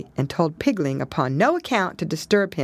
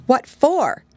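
a touch higher and louder; lightly voiced young pig; 9 semitones higher, 5 dB louder than usual. Pigling asks Pigwig why. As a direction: ask in surprise. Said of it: "Pigling helped himself".

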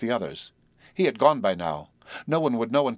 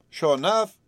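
higher, louder mock surprise; affected surprise; 7 semitones higher, 4 dB louder than usual. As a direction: play it as put-on surprise. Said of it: "she said in affected surprise".

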